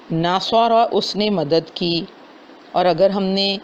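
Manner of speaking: ordinary talk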